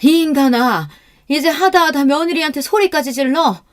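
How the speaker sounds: high, loud wifely outburst; angry berating. Acted. Mother-in-law berates her husband. (남편에게 버럭 화내는 높은 목소리)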